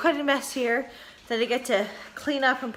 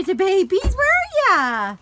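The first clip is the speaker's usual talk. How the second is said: high, slow sing-song call; 8 semitones higher, 4 dB louder, slower than usual. Real, not acted. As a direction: coo for the pups. Calling dogs at home.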